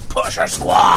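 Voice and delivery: loud segment callout; shout. Acted. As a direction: call out the segment title. Segment name called out.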